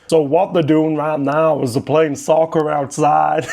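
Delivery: light, jokey put-on American accent; a lightly voiced American accent, played for laughs. Explaining football to American viewers in a light American accent. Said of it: "I need to do my American voice".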